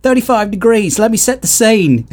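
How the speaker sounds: high-pitched broad Aussie recital; Australian accent. Retold or imitated. Quoting the band's song lyrics to a co-host, Australian accent. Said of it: "And it's like".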